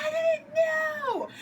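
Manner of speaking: high, very slow loud wail; mock wailing plea. Leader lady mocked, scissors in neck, high wailing plea. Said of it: "She's like".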